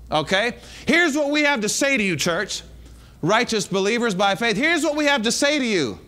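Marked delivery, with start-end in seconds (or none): none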